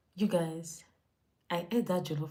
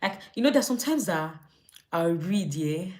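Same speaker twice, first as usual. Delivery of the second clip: usual speaking voice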